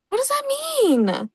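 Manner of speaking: very high slow squeak of confusion; squeaky baffled. Pretend. Repeated much higher.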